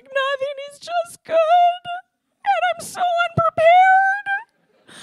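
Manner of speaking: high, breaking voice through tears, slow at the end; crying. Retold or imitated. Retelling answering his wife backstage, voice breaking in tears. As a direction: choke it out, overwhelmed with gratitude. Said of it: "and I just, I just start crying".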